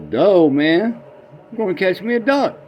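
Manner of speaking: put-on high, dim little boy saying duh; dopey boy voice. Old man asks the boy, dopey high kid voice. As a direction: play the kid as a cheerful simpleton.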